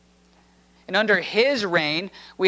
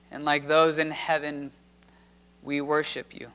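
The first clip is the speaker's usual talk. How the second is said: softer, hushed prayer voice; 6 semitones lower, 5 dB quieter than usual. Acted. Pastor prays with the congregation after the reading, hushed and reverent. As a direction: pray quietly and reverently.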